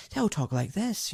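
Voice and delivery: lightly mimicked posh West End Glasgow accent; a touch of posh West End accent. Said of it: "it's all very posh".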